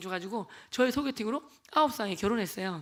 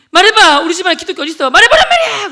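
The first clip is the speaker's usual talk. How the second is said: furious shout, much higher and louder; angry yelling cousin; 7 semitones higher, 18 dB louder than usual. Retold or imitated. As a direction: yell it at him like a raging cousin. (화난 사촌 형의 고함)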